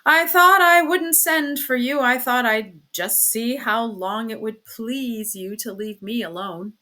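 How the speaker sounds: loud, drawn-out martyred complaint, easing off; pseudo-resignation. A narrator's reading. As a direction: play the injured, neglected husband. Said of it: "in a tone of pseudo-resignation".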